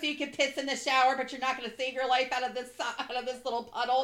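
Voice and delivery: hammed-up scolding with giggles; laughing mock rebuke. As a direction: tease through laughter. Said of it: "they're like".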